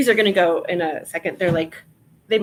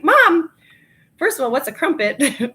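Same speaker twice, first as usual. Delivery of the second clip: high, loud disbelieving question; mock-shocked daughter to mom; incredulous; 6 semitones higher, 7 dB louder than usual. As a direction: ask it in amused disbelief. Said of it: "I was like".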